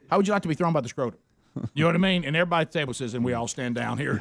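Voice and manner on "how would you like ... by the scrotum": rapid-fire topper; fast one-upping. Retold or imitated. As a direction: rush it out to top them. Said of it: "Brian Regan thing".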